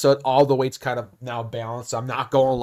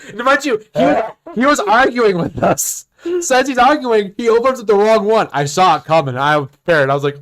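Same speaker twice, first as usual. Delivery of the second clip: ordinary delivery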